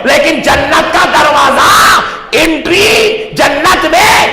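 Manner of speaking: slow, stretched proclamation rising in pitch; rousing sermon climax with long held vowels; emphatic preaching. Real, not acted. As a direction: draw out each phrase for the crowd. Preaches at full force.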